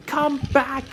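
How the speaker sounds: high-pitched yell after someone; alarmed yell. Acted. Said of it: "he yelled".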